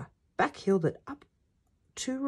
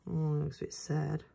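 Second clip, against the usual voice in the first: ordinary delivery, nothing marked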